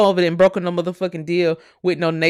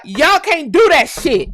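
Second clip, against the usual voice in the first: exasperated scolding; 7 semitones higher, 6 dB louder, slower than usual. Real, not acted. Scolding other streamers.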